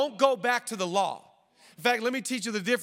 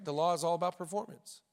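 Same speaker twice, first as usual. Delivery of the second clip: The law is all about performance, softer and fast, tossed off; quick quiet restatement; 4 semitones lower, 6 dB quieter, faster than usual. Shown as a demonstration. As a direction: say it again under your breath, quickly.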